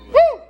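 a sudden loud high whoop. Real, not acted. Preacher whooping to the congregation, loud and high.

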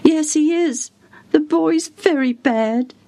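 high, grave servant; sad servant. Acted. Servant tells the caller the boy is very bad. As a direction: answer the door heavily.

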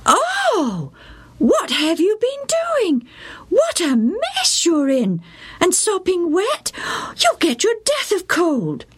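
high, fretting mother's voice; dismayed mum; fussing mother. A narrator's reading. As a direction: scold and fuss over the soaked boy. Mother scolds soaked Joe.

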